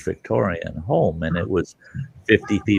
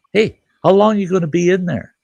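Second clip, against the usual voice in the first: raised high call; 11 semitones higher, 5 dB louder than usual. Retold or imitated. Couple at the sidewalk calling up to Santa, raised voice. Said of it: "And they go".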